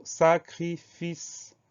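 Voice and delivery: "sacrifice" very slowly split as sa-cri-fice; syllable by syllable. Shown as a demonstration. Teacher introducing the next word, sacrifice slowly broken into syllables. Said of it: "on a quatre syllabes".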